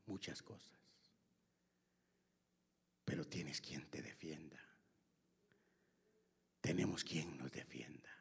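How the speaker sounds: low soft whisper; hushed reassurance. Acted. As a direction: whisper the comfort. Whispered sermon lines.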